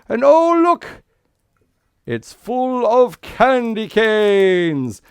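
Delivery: high, loud, slow gasp of wonder; mock surprise. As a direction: play amazed for the children. Lid lifted on magic pan.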